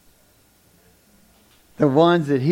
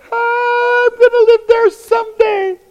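mock dreamy, much higher; wistful sigh; 8 dB louder than usual. Passing the unfinished house daily, mock wistful sigh. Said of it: "I go by it every day and go".

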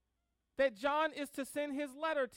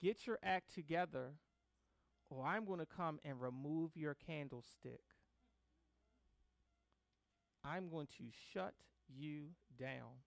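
Jesus; 7 semitones lower, 8 dB quieter than usual. Acted. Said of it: "Jesus will say to them".

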